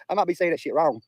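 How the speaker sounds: ordinary talk, nothing marked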